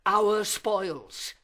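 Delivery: indignant outburst. Acted. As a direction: fling "our spoils" out in outrage. Villain's outburst in soliloquy.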